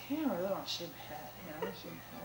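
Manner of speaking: soft, fast murmur of temptation; quiet inner voice. Retold or imitated. Talking herself into a head shave, quiet murmur. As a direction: hush it like a tempting thought. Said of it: "I was like".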